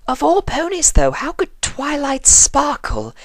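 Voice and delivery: Rarity baffled that Twilight was arrested; incredulous refined lady. Acted. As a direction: throw up your hooves in theatrical wonder.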